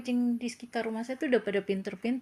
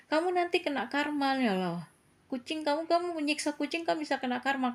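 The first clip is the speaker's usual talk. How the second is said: retold firm telling-off, pitched higher; firm, slightly curt; 7 semitones higher than usual. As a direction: warn the boy sternly, curt but without harsh words. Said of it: "nada saya tegas dan rada jutek". (teguran tegas dan agak jutek dengan nada lebih tinggi)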